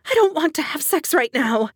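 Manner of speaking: high, strained protest; choked, frightened plea. A narrator's reading. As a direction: force the words out in fear. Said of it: "force a whisper past the lump in my throat".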